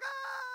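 high panicked shriek from on a chair; mock shriek. Pastor imitating mom shrieking from the chair over the mouse. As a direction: scream like mom spotting a mouse. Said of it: "Mom is standing over on a chair like".

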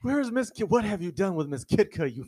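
loud, high mock-melodramatic demand; hammed-up outraged hero. Fan imitating Bruce Wayne confronting his captors, hammed-up outrage. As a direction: imitate a campy hero raging at villains.

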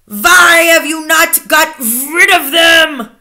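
loud, drawn-out witch's scream. A narrator's reading. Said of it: "she screamed".

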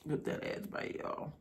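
voiceless whisper. Real, not acted. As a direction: breathe it out as a whisper. Mouth at food, voiceless.

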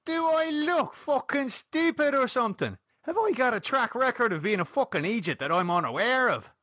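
high-pitched offended protest; affronted, incredulous questioning; aghast and indignant. A narrator's reading. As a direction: demand answers in high-pitched outrage. Aghast at the accusation.

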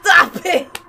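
loud startled shout; frightened scream. Real, not acted. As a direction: yell in panic at the scare. Jump scare scream.

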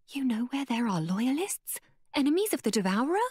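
can't contain her hope; excitedly asking. Acted. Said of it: "asked Mei Lin excitedly".

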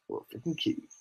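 hissed angry whisper; whispered threat. Real, not acted. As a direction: breathe the threat out, voiceless. Repeated as a whisper.

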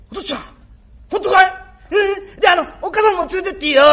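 high and loud with delight; can't believe the good news; thrilled boy. Acted. Boy overjoyed when father promises eels, high and loud.

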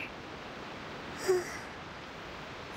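drawn-out very soft sigh; a slow worn-out breath out; long tired sigh. Acted. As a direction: sigh with drained energy. Waiting to regain energy.

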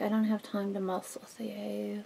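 under-the-breath whisper. Real, not acted. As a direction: mutter it in a whisper. Unvoiced whisper to object.

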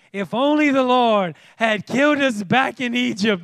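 high, loud whiny moan; hammed-up moaning complaint; moaning Israelites. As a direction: whine it like a spoiled crowd. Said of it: "they moaned".

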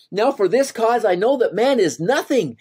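high, overwhelmed exclamation; stunned by what he has seen; awed Moses. Acted. Moses, coming to himself, cries out, awed and high.

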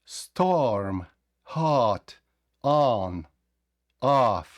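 Hot, on, off with an open vowel between o and a. Shown as a demonstration. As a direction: say hot like a blend of o and a. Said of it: "Щелепу просто опускаєте".

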